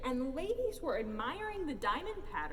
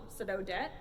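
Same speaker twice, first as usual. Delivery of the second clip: ordinary delivery, nothing marked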